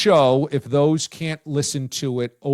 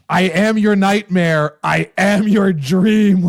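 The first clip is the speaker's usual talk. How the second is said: a hint of a slow menacing villain line; quoting Freddy Krueger; 5 semitones higher, 5 dB louder, slower than usual. Co-host quotes the script, mock menace. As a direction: lightly read the horror quote. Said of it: "like Freddy Krueger".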